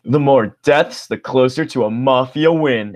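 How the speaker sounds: Broomer voice. Acted. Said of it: "He doesn't sound like Broomer anymore".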